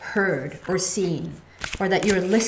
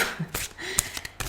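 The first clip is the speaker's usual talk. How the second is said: quiet high laugh; 10 semitones higher, 13 dB quieter than usual. Real, not acted. Laughing at her own remark.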